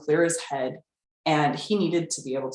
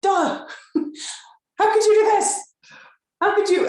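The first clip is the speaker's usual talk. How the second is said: hammed-up squeal of outrage, much higher and louder; mock outraged shriek; 6 dB louder than usual. Retelling how she reacted to the eBay trailer, a hammed-up high squeal. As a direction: play your past self's indignant squeal to the husband. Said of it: "And I was like".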